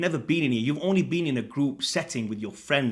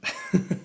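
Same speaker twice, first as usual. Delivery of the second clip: short unvoiced snort of a laugh; a quick breathy chuckle; 6 semitones higher than usual. Real, not acted. Laughing while eating peanuts.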